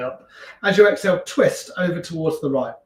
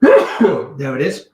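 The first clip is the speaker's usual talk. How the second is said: sneezing; 10 dB louder, much slower than usual. Real, not acted. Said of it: "I think I'm gonna sneeze".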